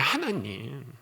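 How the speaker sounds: ordinary talk, nothing marked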